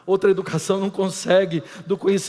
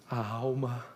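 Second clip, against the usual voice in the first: drops much softer into a whisper; fading to whisper; 8 semitones lower, 15 dB quieter than usual. Real, not acted. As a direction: let it die away to a breath. Final dramático sussurrado. (voz sumindo até o sussurro)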